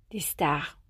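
soft breathy whisper for effect. Acted. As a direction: hush the word like a secret. Intimate hushed emphasis on stars.

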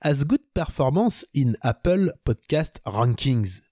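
put-on slow English read in a thick French accent; clumsy French-accented English, drawn out. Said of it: "vous pardonnerez, euh, mon accent".

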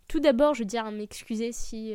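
ordinary talk, nothing marked

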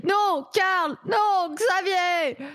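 hammed-up anguished cry, slow; mock dramatic shout. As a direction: overplay the tragic shout. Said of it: "vous avez choisi les noms les plus dramatiques".

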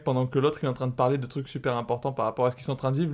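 ordinary talk, nothing marked

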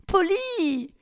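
very high alarmed cry of his name; shocked cry. A narrator's reading. Victoria crying out Polly's name in shocked alarm. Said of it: "s'exclama Victoria".

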